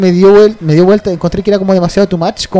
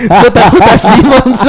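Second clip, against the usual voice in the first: laughing; 6 semitones higher, 4 dB louder, much slower than usual. Retold or imitated. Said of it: "hace rato dije".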